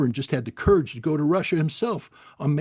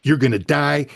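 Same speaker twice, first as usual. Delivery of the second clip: ordinary delivery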